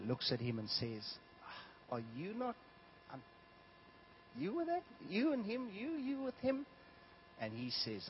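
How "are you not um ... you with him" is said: put-on high, soft questioning girl voice; a timid servant girl pointing him out, pitched up high; slave girl voice. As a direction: servant girl asks Peter, high and hesitant.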